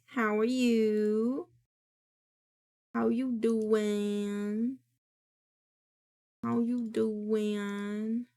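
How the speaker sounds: very slow, stretched greeting; slow, lingering hello; drawn-out greeting. Pretend. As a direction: stretch every word lazily.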